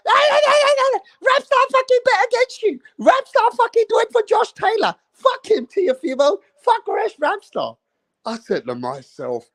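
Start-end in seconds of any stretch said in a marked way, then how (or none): 0.1-7.8 mock high-pitched voice of the heckler; mocking impression. Mocking the old heckler to viewers, high mock voice. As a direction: squeak it out higher, mocking him. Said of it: "And this guy's going".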